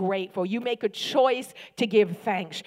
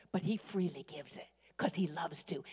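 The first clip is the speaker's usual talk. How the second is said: whispered aside; 3 semitones lower, 8 dB quieter than usual. Real, not acted. Intimate aside in sermon.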